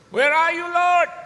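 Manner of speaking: full high-pitched yell; retold desperate shout. Father in the car park cries to God, desperate shout. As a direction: cry out to heaven at the top. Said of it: "saya teriak".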